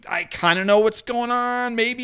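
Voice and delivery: high, slow unsure voice, hedging everything; clueless guy. Retold or imitated. Host mocking the coach: high, slow, unsure hedge. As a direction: play a coach who barely knows what's happening. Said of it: "someone who's kinda like".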